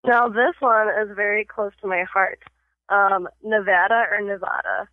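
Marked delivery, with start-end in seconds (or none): 3.4-4.9 "Nevada" as nuh-VAD-uh, then nuh-VAH-duh; two pronunciations. Shown as a demonstration. Host quizzing a guest, "Nevada" said both ways. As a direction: offer "Nevada" with cat vowel, then father vowel. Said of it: "I have a pronunciation test".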